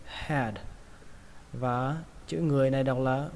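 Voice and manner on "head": "Head" ending on a sounded D; audible final D. Shown as a demonstration. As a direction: add a clear D to the end of "Head". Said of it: "các bạn thêm âm đờ nhé".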